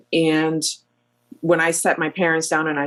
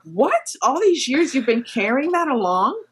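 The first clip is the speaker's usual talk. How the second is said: high, astonished questioning; can't believe what she just heard; child learning of mom's secret, high amazed disbelief; 8 semitones higher than usual. Retold or imitated. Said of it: "And I'm like".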